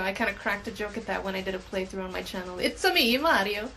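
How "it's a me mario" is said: mock Italian game-mascot voice, lifted at the start; Mario. Gamer jokes about a character's accent, playful Mario impression. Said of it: "he sounds like Mario".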